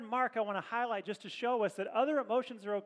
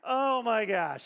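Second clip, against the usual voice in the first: laughing exclamation; 3 semitones higher, 8 dB louder, slower than usual. Real, not acted. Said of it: "I can't even with you guys".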